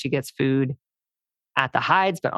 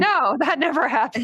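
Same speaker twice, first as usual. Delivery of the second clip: sarcastic denial; 11 semitones higher than usual. Real, not acted. Joking about training going south.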